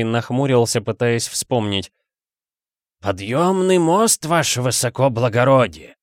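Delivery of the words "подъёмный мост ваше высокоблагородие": raised servant's alarmed reply; calling back. A narrator's reading. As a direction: sing out the answer, stretching the words. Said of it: "откликнулся Ассолин".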